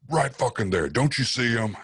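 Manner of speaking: retold yell. Storyteller voicing a possessed friend in a mock yell. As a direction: act out a friend ranting at nobody.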